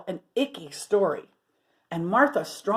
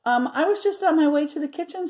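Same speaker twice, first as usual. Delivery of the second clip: George caught out; 7 semitones higher, 4 dB louder, faster than usual. Acted. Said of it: "said George".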